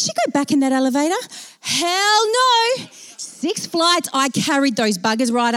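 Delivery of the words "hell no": high, drawn-out sassy refusal; emphatic refusal. Real, not acted. Storyteller on riding that elevator again, high emphatic refusal. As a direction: belt out the punchline. Emphatic answer to own question.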